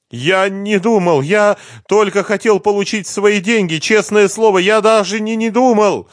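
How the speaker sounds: high, desperate wail; crying. A narrator's reading. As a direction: wail your excuse in a high tearful voice. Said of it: "продолжал плакать Лихоносов".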